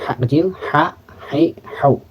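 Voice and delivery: breathy, unvoiced throat "ha", "hai", "hau"; whispered ha. Shown as a demonstration. Teacher shows the small ha as a whisper. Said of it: "Seperti orang berbisik lah".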